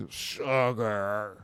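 low, odd alien-in-human voice. Retold or imitated. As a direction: say it like an alien badly passing as human.

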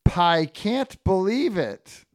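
high, slow pun delivery; punning pepperoni Ernie. Acted. Ernie answers Frank with a pun, hammed-up amazement. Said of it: "And then Ernie says".

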